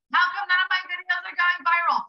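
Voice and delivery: high whiny complaint; whiny self-mockery. Mocking her own complaint to viewers, high and whiny. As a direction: whine it like a sulky kid.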